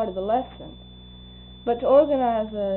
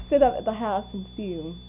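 normal talking voice

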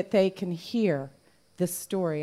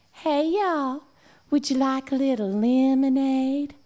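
put-on sweet southern girls; 6 semitones higher, 4 dB louder than usual. Girls greeting the visitors.

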